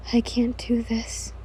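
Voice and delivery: slow, hushed, voiceless murmur; despairing whisper. Acted. You overcome by grief, despairing whisper. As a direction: whisper it as if giving up. Grieving woman giving up.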